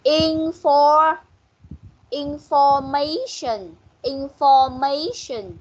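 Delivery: information drawn out, stress on "ma"; stressed syllable demo. Shown as a demonstration. Teacher correcting a student, slow stressed "ma" in information. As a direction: sound out information slowly, lifting "ma" before falling on "tion". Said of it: "Rồi, nhấn ở đâu?".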